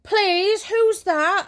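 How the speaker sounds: put-on high, loud childish plea; pleading child. Young self pestering mum from bed, high loud plea. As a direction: whine to be let down. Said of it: "I'm like".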